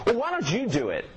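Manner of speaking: high, exasperated snap back at students; frustrated retort. Retold or imitated. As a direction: throw it back at them, fed up. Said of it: "our frustration goes".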